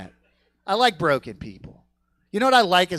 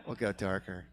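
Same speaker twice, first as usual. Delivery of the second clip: lower, softer; 9 semitones lower, 13 dB quieter than usual. Shown as a demonstration.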